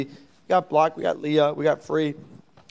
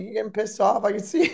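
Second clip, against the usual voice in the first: ordinary talk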